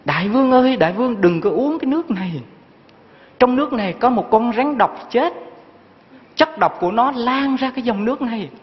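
high-pitched retold cry of warning; soldier calling out. Soldier on the cliff warning his thirsty king, retold calling out. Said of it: "người lính la lên".

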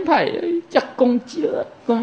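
high, soft wail of a distressed follower; mock distressed plea. Distraught follower appealing to the monk, mock despairing plea. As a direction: plead faintly and high, as if done for.

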